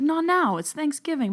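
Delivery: imitating a flustered refusal; mock protest. Susan brushing off her dad's spirit, mock protest.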